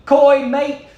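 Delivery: put-on loud, high-pitched amateur Australian accent; an American belting out a bad Australian accent. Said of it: "I apologize in advance for my Australian accent".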